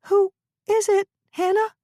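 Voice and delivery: high voice, on the edge of a sob; tearful Lisa. Acted. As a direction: ask through rising tears. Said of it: "gave a little sob".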